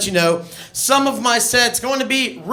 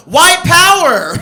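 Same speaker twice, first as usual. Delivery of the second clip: higher, louder, slow triumphant yell; proud ranger shout; 9 semitones higher, 8 dB louder, slower than usual. Retold or imitated. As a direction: belt it out like a proud kid in costume. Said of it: "I just go".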